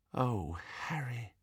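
very soft, slow murmur; tender sympathy. A narrator's reading. Hermione after reading his mother's letter, tender sympathy. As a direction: breathe it out softly, full of sympathy.

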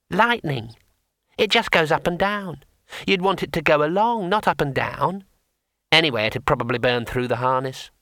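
a small painted imp, unhurried; tiny imp. Acted. Imp settling the matter for the wizard, small creature voice.

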